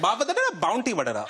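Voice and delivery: mock Sri Lankan gibberish, high at first; mum's coded gossip. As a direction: imitate mum slipping a code word into Sri Lankan chatter. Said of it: "she'll try and code it up".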